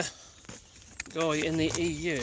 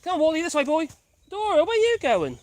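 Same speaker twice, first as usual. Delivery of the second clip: high, louder call to the dogs; 8 semitones higher, 5 dB louder, faster than usual. Real, not acted. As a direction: shout after a wandering dog. Calling dogs on a walk.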